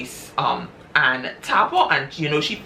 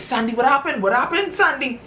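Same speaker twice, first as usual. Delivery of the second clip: much higher, alarmed calling; panicked husband; 9 semitones higher than usual. Retold or imitated. Husband to his collapsing wife, high panicked calling.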